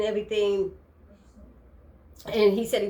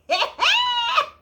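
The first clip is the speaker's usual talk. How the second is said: shrieking laughter, much louder; real, helpless shriek of laughter; loud high laugh; 6 dB louder than usual. Real, not acted. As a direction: burst out laughing hard. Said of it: "Hannah be cracking me up".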